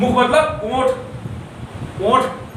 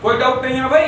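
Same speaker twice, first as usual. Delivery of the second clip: ordinary talk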